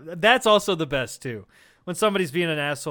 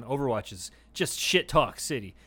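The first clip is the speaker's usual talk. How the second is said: ordinary delivery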